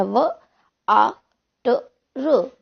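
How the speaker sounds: "व", "आ", "ट", "र" said as separate sounds; phoneme by phoneme. Shown as a demonstration. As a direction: pause between each sound of "व आ ट र". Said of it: "देखिए मैंने हिंदी में बोला".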